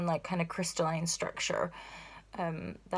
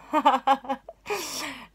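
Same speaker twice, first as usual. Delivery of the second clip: higher-pitched laugh; burst of laughter; 6 semitones higher, 4 dB louder than usual. Real, not acted. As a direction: laugh out at your own silliness. Laughs at own exclamation.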